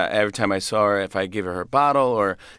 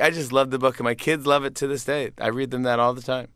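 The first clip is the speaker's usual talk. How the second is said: usual speaking voice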